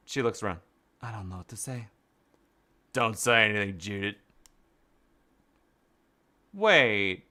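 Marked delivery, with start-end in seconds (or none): none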